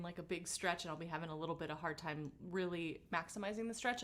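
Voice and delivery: normal talking voice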